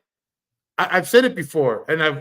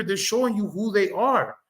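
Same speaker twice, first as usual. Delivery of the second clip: usual speaking voice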